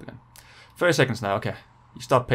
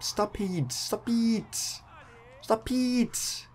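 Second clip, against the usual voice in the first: quiet whine climbing higher each time; rising whiny plea; 8 semitones higher, 7 dB quieter, much slower than usual. Real, not acted. Gamer whining at the rival attacking him, soft and rising. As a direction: plead softly, going higher and higher.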